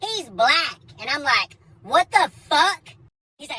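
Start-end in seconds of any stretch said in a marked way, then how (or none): 1.8-2.9 higher, louder, slow; shocked shout at the intruder; mock startled outburst; startled yell. Startled with shotgun.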